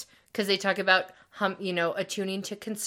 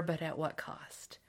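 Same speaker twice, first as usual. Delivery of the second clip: whispered, slow and ominous; dramatic whisper; 3 semitones lower, 13 dB quieter, slower than usual. Acted. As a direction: breathe it out like a movie villain. Dramatic rhetorical line.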